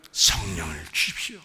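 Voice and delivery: hushed, whispered plea to God; whispered prayer. Shown as a demonstration. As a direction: whisper it like an earnest request. Demonstrates a prayer of request. (속삭이듯 간구하는 기도)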